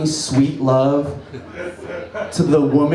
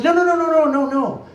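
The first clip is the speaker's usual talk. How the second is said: mock girlfriend; 12 semitones higher, faster than usual. Girlfriend rejecting quote.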